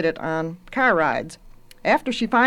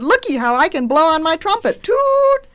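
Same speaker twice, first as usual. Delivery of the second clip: put-on high, loud showing-off small boy; high-pitched childish excitement with trumpet noise; excited little brother; 10 semitones higher, 6 dB louder than usual. A narrator's reading. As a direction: boast like a little kid, ending in a squealed toot. Little brother shows off his trumpet.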